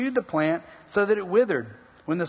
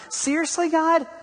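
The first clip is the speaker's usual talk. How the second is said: exasperated at God; 11 semitones higher than usual. Retold or imitated. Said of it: "I mean, seriously?".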